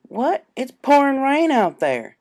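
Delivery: high, loud and slow, in disbelief; shocked. Acted. Applejack shocked to hear Rainbow's out in the storm, high and loud. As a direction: gasp it out, she can't believe it.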